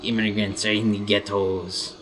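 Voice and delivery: deliberate, hammed-up French accent; mock French accent. French guys defend their hardcore hip-hop, mock French accent. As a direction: boast like a French rapper in a put-on French accent. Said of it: "these French guys are like".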